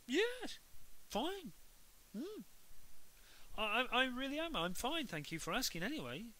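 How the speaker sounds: Henry faking perfect manners to mum; overly polite Henry. A narrator's reading. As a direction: play the perfect boy a bit too hard. Henry being too polite.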